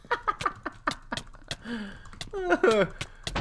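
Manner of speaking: drawn-out high laugh at himself; long high giggle. Real, not acted. Gamer laughing at his wasted pearl. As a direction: giggle at the blunder.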